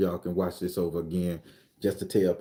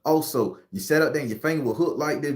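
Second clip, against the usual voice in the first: ordinary delivery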